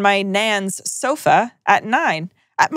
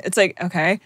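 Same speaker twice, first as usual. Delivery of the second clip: ordinary delivery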